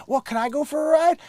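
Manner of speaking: high-pitched imitation of a wheedling woman; eager woman. Gold digger asking the owner for a ride, eager.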